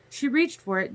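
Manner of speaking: plain reading voice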